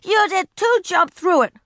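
put-on high, loud shouting child. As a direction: yell it back. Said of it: "she shouted".

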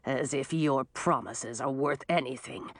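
low, understated scorn; lightly voiced contemptuous dragon; scornful snort. A narrator's reading. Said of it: "Frosty Breath snorted".

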